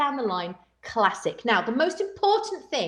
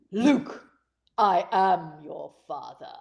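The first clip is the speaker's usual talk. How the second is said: low, slow menacing Vader reading; Darth Vader impression; 6 semitones lower, slower than usual. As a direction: drop deep and drag it out like Vader. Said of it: "he could have said it like this".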